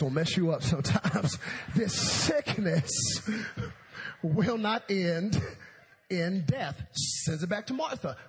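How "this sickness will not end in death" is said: loud, slow, broken into chunks; deliberate dictation. Acted. Jesus texts his reply to Martha, slow emphatic dictation. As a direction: spell out the message firmly, pausing between phrases.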